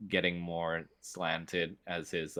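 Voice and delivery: ordinary delivery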